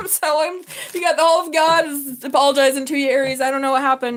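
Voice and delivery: excited laughing outburst. Real, not acted. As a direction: blurt it out, laughing.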